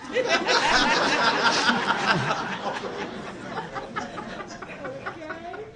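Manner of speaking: high wheezy giggle fading; long breathy laugh. Real, not acted. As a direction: laugh high through the joke. Laughs mid-joke.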